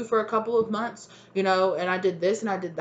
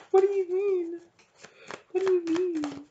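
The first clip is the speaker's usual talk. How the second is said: laughing disbelief; 11 semitones higher than usual. Pretend. Incredulous laughing reaction.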